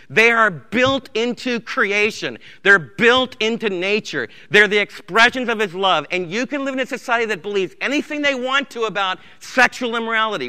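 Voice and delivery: loud, ringing pulpit delivery; emphatic sermon voice; full-force preaching. Acted. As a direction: preach it out to the whole room.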